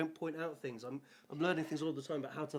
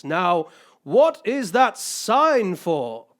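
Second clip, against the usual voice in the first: slow, loud and booming movie-star rhino; drawn out and loud, like a big star startling a child; deep rhino voice; 4 semitones higher, 15 dB louder, much slower than usual. Acted. Rhino star startling little Milo, slow booming voice. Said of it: "he heard a deep voice".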